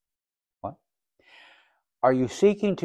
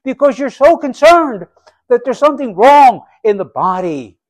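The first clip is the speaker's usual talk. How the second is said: much higher and louder, building urgency; fervent preaching; 9 semitones higher, 11 dB louder than usual. Acted. Preacher rousing his congregation, fervent loud climax. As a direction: lift the whole room with it.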